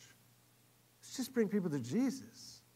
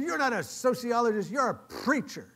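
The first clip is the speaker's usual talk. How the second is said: loud, high, punching each phrase; indignant accusation; 7 semitones higher, 6 dB louder than usual. Retold or imitated. Diner owner accusing the man who prayed, indignant. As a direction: expose him with a raised voice.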